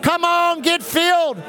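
high, driving chant of unknown syllables; fervent preaching in tongues. Real, not acted. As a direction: pour it out like a revival preacher. Preacher chants then speaks tongues.

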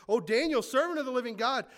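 anguished cry. A narrator's reading. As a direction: cry out to Daniel in the den, pleading. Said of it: "he cried out in a tone of anguish".

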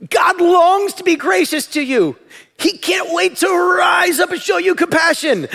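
high, impassioned proclamation; full-force preaching. Real, not acted. Preacher showing the congregation the tension in the verse, full-force preaching. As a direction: proclaim it loudly, drawing out key words.

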